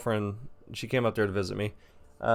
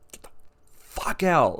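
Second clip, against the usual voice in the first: retold angry outburst, raised in pitch; mad, cracking voice; 5 semitones higher, slower than usual. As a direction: act out being so mad the voice cracks. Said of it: "my voice is just cracking 'cause I'm getting so mad".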